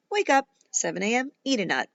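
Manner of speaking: plain reading voice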